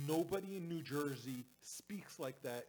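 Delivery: slow, hammed-up New Jersey accent, loud; mock New Jersey accent. Jersey guy mocking the accent people expect, bad New Jersey accent. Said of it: "wrong accent".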